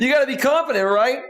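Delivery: high laugh then words through it; real laugh spilling into the advice. Real, not acted. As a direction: laugh it out, amused at yourself. Laughing at his own story.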